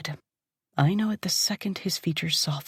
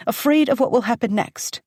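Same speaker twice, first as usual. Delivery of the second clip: usual speaking voice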